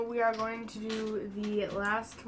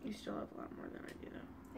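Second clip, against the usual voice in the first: whispering; 17 dB quieter than usual. Real, not acted. Aside about sauce amount.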